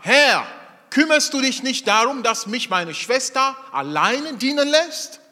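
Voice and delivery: Martha's complaint. Acted.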